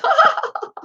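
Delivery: very high acted laugh; short shrieking mock laugh; shrieks of merriment. A narrator's reading. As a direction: squeal of crowd laughter. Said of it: "Shrieks of merriment".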